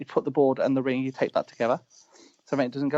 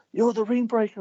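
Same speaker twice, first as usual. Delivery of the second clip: higher, pointed accusing voice; mock accusation; 10 semitones higher than usual. Wrestler accusing his mate of breaking the ring, mock accusation. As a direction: act out a colleague's accusation. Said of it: "then Matt was like".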